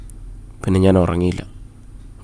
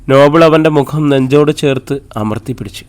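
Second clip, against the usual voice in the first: plain reading voice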